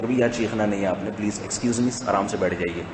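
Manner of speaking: low, soft, polite office voice; quietly and courteously correct an employee; hushed, unruffled managerial request; calm manager. Acted. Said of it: "کتنے آرام سے بات کرتے ہیں،".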